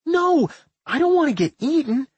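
high-pitched panicked wail; horrified. A narrator's reading. Millie fears being eaten by the slime, horrified. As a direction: protest in horror, voice shooting up. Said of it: "Millie screamed in horror".

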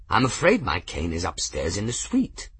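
plain reading voice

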